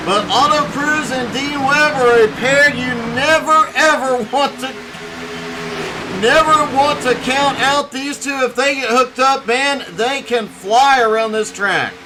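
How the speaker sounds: punchy live motorsport commentary; excited play-by-play. Real, not acted. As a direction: call the restart with building excitement. Live restart call.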